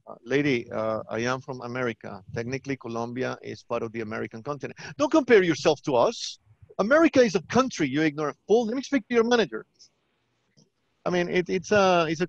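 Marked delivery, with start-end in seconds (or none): 0.1-4.8 put-on lower, softer Colombian-accented reply; Colombian call-center agent. Agent correcting an American caller, lower Colombian accent. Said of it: "I am Colombian". 5.0-9.7 higher, louder mock outrage; indignant American caller. American caller demanding the manager, mock indignant.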